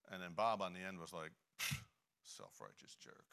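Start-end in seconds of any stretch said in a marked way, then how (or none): 1.6-3.3 scornful mutter. Retold or imitated. Bob scoffs at the believer.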